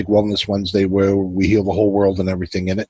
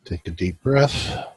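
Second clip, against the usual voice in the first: guided meditation. Acted. Host guiding his viewers into relaxation, calm guided meditation. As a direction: ease the listeners into stillness.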